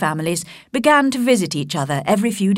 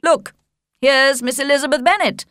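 higher, hearty host's voice; cheerful Sir William; 6 semitones higher, 4 dB louder than usual. A narrator's reading. Sir William pointing out Elizabeth to Darcy, cheerfully. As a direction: announce her with jovial delight.

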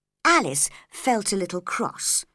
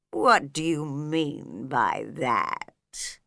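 lower, very slow, drowsy questioning; lazy caterpillar drawling the words; 5 semitones lower, much slower than usual. A narrator's reading. Caterpillar questioning Alice, low slow drawl. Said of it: "said the caterpillar".

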